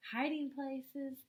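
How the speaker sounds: ordinary delivery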